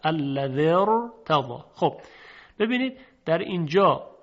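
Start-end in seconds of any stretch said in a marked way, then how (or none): 0.0-1.6 slow الذی ارتضی, short i straight into a full ra; joined, heavy ra. Shown as a demonstration. Teacher shows kasra before silent ra, joined slow demo. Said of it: "کسرهٔ زال هست".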